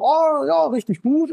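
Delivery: high, beaming "oh yes, really good"; delighted taxi driver. Retold or imitated. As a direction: gush like a cabbie whose business is booming. Taxi driver raves about business.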